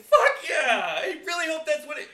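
high, loud acted enthusiasm; elated shout. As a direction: cheer it out at high pitch. Acts out delight at acronym.

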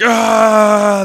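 long, loud shout, pitched high; sustained mock battle yell; running yell. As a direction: holler "ah" as if charging down the street. Imitates Damien yelling as he runs.

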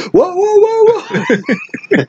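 very high, falsetto panicked whoas; mock panic, squealed and breaking into laughter; imitated alarmed yell. Recapper imitating Michael as the watermelon bounces, yelling. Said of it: "and then he yells".

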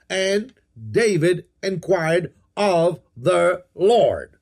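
very slow, each word set apart. Shown as a demonstration. Preacher pointing out six words to underline, word by word. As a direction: spell out "And David inquired of the Lord" one word at a time.

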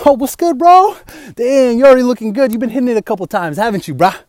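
loud, hyped gym bro greeting. Acted. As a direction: pump up the client like a gym bro. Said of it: "I'm gonna be the gym bra".

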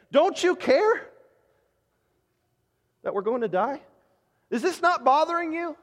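high, desperate plea; panicked disciples. Acted. Preacher reading disciples' plea to Jesus, high and desperate. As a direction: cry out like frightened men in a storm. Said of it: "And they ask him".

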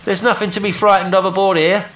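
high, loud call over the rail; shouted reassurance. A narrator's reading. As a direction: reassure someone far off at full voice. Will calls reassurance to boat.

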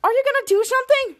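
high, loud urgent question; pushing the girls to act; urgent plea. Retold or imitated. Riley pressing the girls for help, high and urgent. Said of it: "Catcher Riley says".

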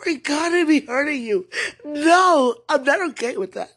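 sobbing, loud and high; crying shout. Real, not acted. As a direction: wail it through real tears. Crying confrontation with spouse.

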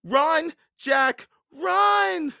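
very high, loud cries; crying out. A narrator's reading. Annie urges Jack away from charging pirates, crying out. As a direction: shout each word as a separate alarm. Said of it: "cried Annie".

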